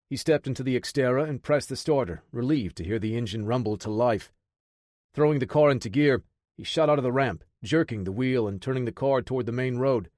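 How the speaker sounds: ordinary delivery, nothing marked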